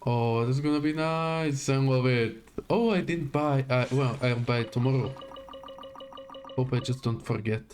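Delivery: usual speaking voice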